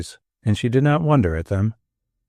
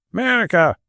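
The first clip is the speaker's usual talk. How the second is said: mild Manchester bellow; 7 semitones higher than usual. A narrator's reading. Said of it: "he bellowed".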